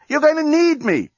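high-pitched, desperate yell. A narrator's reading. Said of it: "yelled the journalist".

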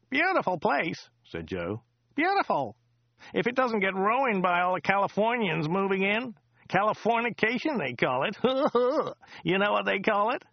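high, chatty local driver voice; chatty driver Joe. Acted. Driver Joe chatting to Dirk on the drive, high chatty voice.

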